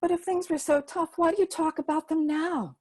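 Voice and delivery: put-on high girl's voice, puzzled question; a small girl who doesn't understand; curious child. Libby asking Gima why she tells tough stories, high curious girl voice.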